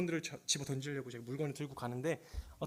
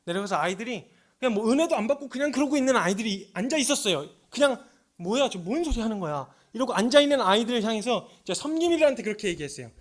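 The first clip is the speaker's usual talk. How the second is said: loud, higher impassioned sermon voice; fervent preaching; 7 semitones higher, 10 dB louder than usual. Acted. Preacher retelling his call to repentance, loud and impassioned. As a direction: preach it with full force.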